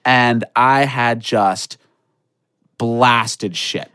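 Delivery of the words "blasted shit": ordinary delivery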